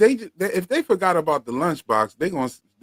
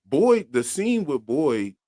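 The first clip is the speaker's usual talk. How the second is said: usual speaking voice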